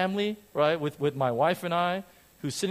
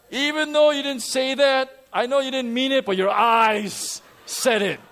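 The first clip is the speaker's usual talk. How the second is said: higher, louder playful take on his wife; mock wife; 7 semitones higher, 6 dB louder than usual. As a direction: tease like the wife pointing at his eyes. Voicing wife's remark.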